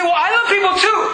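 high-pitched defensive excuse; mock protest. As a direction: protest like an indignant church member. Acting a protesting church member's excuse.

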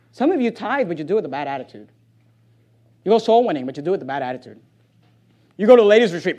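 usual speaking voice

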